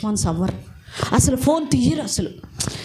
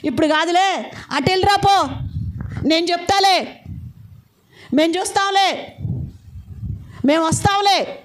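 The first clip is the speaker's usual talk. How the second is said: dismissing a beggar; 8 semitones higher than usual. Retold or imitated. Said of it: "ఏదో అడుక్కునేవాడు వస్తే".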